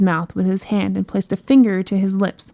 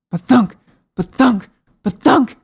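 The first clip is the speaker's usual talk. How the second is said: booming thumps; 3 semitones higher, 5 dB louder than usual. Acted. Footsteps sound effect.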